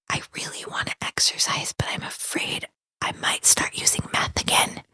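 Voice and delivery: soft, fully whispered admission; re-enacted confession in a hushed whisper. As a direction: whisper it like a secret being confided. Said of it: "and I whispered".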